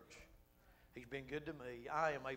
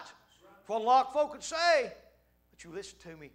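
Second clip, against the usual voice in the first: normal talking voice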